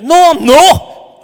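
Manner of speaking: loud, high, drawn-out mock protest; hammed-up indignant denial. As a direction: protest it loudly, stretching the words. Retold denial to his wife.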